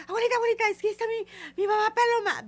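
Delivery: put-on high, loud frightened child's cry; scared child. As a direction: call for grandma like a scared boy who just saw a ghost. Nieto asustado llama a la abuela. (niño asustado, agudo y fuerte)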